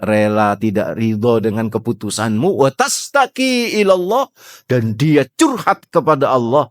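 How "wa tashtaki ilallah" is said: higher, slow chanted Arabic; verse recitation. Acted. Preacher chants the verse for the congregation, higher and slow.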